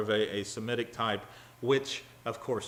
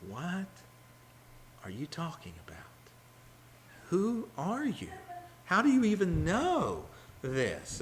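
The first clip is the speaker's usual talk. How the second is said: stunned brothers; 7 semitones higher than usual. Acted. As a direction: ask as if you can't believe it. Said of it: "And there's".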